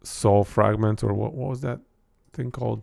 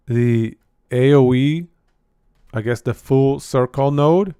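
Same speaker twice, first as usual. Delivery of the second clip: usual speaking voice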